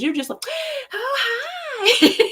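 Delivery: high, laughing "Oh, hi"; [name] teases his calm, high and laughing; laughing mock greeting. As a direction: squeal a greeting through laughter. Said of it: "laughing because she was like".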